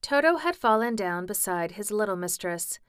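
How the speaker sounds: plain reading voice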